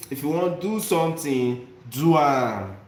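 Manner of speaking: punchy Nigerian pidgin exhortation. Shown as a demonstration. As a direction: urge them on in Nigerian pidgin. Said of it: "that is the Nigerian pidgin way of expressing".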